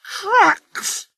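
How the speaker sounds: very high outraged shriek. A narrator's reading. Sir Handel outraged at the quarry trucks. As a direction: shriek the word in horror.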